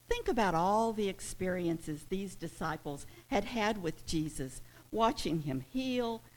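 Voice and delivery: loud, driving sermon climax; preaching at full force. Acted. Preacher to his congregation about the storm, full-force preaching. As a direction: pound every phrase from the pulpit.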